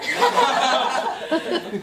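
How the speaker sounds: loud, high laugh; showy laugh. Acted. Playwright delighted by his own witty line, loud laugh. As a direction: laugh heartily at your own joke.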